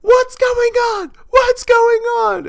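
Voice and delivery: very high, loud, slow shriek; mock screaming. Brother's girlfriend screams back at Neil, very high slow shriek. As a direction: shriek it in overblown panic.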